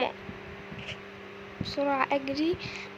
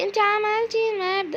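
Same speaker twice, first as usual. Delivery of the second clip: imitating a viewer; 6 semitones higher than usual. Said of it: "هتقولي لي".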